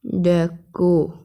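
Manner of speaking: डेको drawn out very slowly as French d'accord. Shown as a demonstration. Teacher slowly models डेको for students, in French. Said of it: "ये गलत है फिर".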